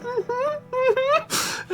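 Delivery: stifled laugh. Retold or imitated. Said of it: "my dad's like".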